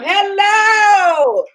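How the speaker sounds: high, drawn-out greeting into a squeal; excited. Real, not acted. Host greeting viewers as the live works, excited. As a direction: sing out the hello and squeal with excitement. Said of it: "I'm excited".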